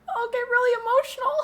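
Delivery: high, tearful voice; getting emotional. Real, not acted. Streamer imagining telling her child about the ornaments, tearful. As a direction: choke up as the tears rise. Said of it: "Why am I getting emotional".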